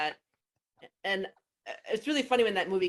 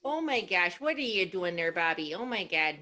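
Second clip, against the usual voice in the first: Bobby's mom; slower than usual. Retold or imitated. Bobby's mom scolding Bobby, Minnesota accent. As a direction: play a fussing cartoon mom. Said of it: "With Bobby's mom".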